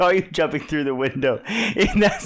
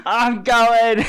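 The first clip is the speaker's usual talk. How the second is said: louder, higher burst, breaking into laughter; mock fleeing yell; 4 semitones higher, 5 dB louder than usual. Co-host acting out fight or flight, mock yell. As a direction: blurt it as if bolting away.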